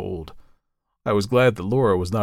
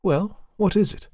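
a touch higher, pressing question; lightly voiced master; 12 semitones higher, 3 dB louder than usual. A narrator's reading. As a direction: ask her straight out, a little impatient. Narrator voices master of house.

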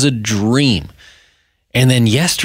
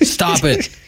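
short, raised mock order; mock stern deprogrammer; 7 semitones higher than usual. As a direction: scold in jest, pitched up. Acting hired deprogrammer scolding returnee.

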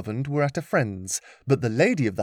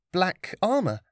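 put-on young girl voice; 7 semitones higher than usual. Lillian answers.

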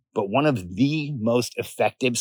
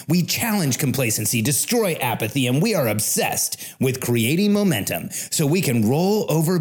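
higher, punchy podcast intro; announcer read; 4 semitones higher than usual. Acted. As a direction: declaim it like a rallying manifesto. Recorded podcast intro manifesto.

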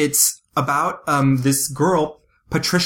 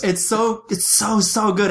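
gushing; 8 semitones higher than usual. Real, not acted. Gushing about the movie.

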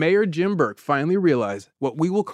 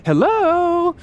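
very high, cheery woman's phone greeting; sunny springtime voice; 4 dB louder than usual. Acted. Rita answers her office phone, sunny springtimey voice. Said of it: "She's got a nice, sunny you know, springtimey voice".

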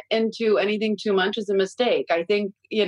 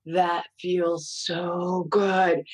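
slow, drawn-out dreamy little voice; lightly voiced blissful child; imitating daughter; much slower than usual.

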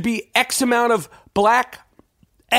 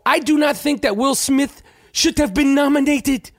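put-on the African accent from Concussion, pitched up, every word spelled out; mock the Concussion accent, no contractions; Will Smith's Concussion accent; 6 semitones higher than usual. Podcaster mocking Will Smith's Concussion role in its African accent. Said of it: "I am doing the same accent that he did in Concussion".

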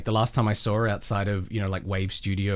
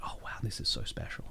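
awed whisper; 3 semitones lower, 12 dB quieter than usual. Retold or imitated. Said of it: "it was like".

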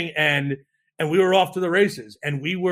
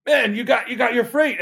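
higher, pumped-up congratulation; excited friend; 7 semitones higher, 4 dB louder than usual. Retold or imitated. Friend overhearing the freight deal story, excited. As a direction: imitate a friend thrilled for you.

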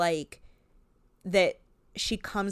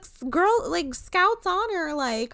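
mimicking Goldie; 10 semitones higher, 4 dB louder than usual. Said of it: "she's like".

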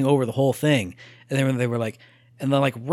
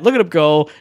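normal talking voice

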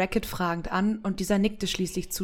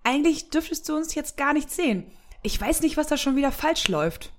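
Samira, puzzled; 7 semitones higher than usual. Acted.

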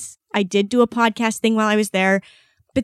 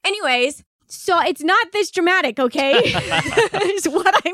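high, tearful voice breaking into laughter; crying while laughing; 7 semitones higher than usual. Real, not acted. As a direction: protest through real tears and a laugh. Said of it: "You're crying".

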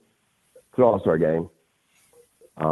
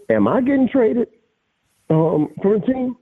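incredulous question; 11 semitones higher than usual. Retold or imitated. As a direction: ask it like you can't believe it. Asking GM directly, incredulous.

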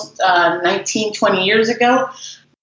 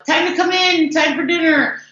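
calling out from the window; 8 semitones higher than usual. Retold or imitated. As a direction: call out like a parent yelling from the window. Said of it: "scream out the window".

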